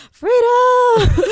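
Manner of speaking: high, loud cry of freedom; joyful shout. Retold or imitated. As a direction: belt it out like chains are breaking. Shouting liberation in the car.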